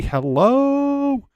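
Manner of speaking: very high, loud, stretched-out hello; playful greeting. Acted. Streamer greeting a viewer, playful high call.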